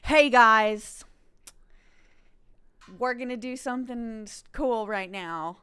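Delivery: loud hyped streamer voice; Mason impression. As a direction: shout it like an over-excited streamer host. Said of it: "This is my impersonation of Mason".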